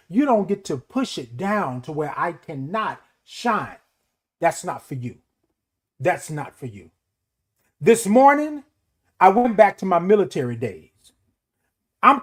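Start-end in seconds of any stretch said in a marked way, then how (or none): 0.1-6.9 quiet and firm. Real, not acted. Telling others off his character, low and quiet. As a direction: settle down low and let the words land.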